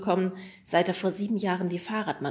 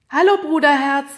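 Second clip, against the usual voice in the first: high, loud call from the background; sister calling; 9 semitones higher, 13 dB louder than usual. A narrator's reading. Sister calling hello to her brother from the background, high. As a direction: call a cheery hello from off-screen.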